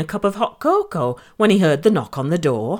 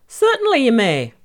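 stable master voice; 5 semitones higher, 4 dB louder than usual. Acted. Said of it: "said the stable master".

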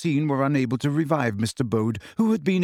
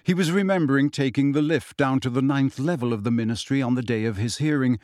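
usual speaking voice

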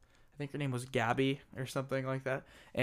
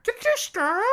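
squeaky high voice; 8 dB louder than usual. Pretend. High mock voices, both speakers.

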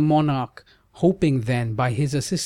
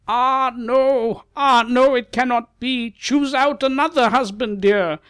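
much higher, louder anguished cry; sage cries out; 12 semitones higher, 4 dB louder than usual. Acted. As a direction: cry out in alarm as the old sage. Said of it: "the Muni cried".

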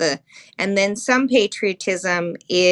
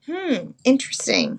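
thinking hmm; 4 semitones higher than usual. Real, not acted. Pondering response.

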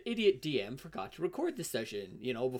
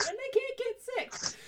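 squeaky high voice; 3 dB quieter than usual. Acted. Mock squeak.

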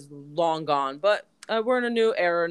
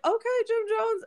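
very high squeal of his name; starstruck. Retold or imitated. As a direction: starstruck fan greeting a celebrity. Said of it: "So I was like".

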